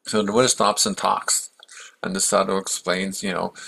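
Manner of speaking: ordinary delivery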